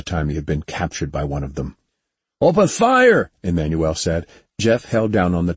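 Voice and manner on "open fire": loud, high battle command; shouted order. A narrator's reading. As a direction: yell the order to fire.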